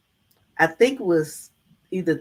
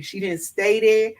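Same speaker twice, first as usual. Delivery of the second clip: usual speaking voice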